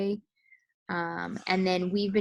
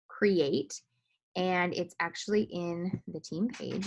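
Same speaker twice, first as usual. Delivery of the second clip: ordinary talk, nothing marked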